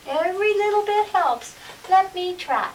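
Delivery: high, eager mouse voice; little mouse. Acted. Little mouse answers the cat, high small voice. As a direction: plead your case as a tiny mouse. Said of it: "said the little mouse".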